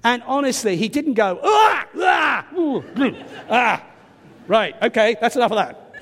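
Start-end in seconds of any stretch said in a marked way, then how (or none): 1.4-3.8 mock horrified gasps. Mock shocked reaction he didn't make.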